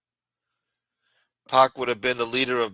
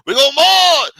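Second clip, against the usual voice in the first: high, loud preacher rally cry; Al Sharpton voice; 14 dB louder than usual. Retold or imitated. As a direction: shout it like a protest preacher. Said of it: "Al Sharpton and Ben Crump coming down".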